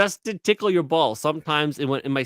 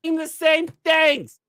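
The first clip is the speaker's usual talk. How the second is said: high, drawn-out mock exasperation; exasperated protest; 13 semitones higher, much slower than usual. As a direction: protest in a high, stretched-out wail. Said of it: "I'm like".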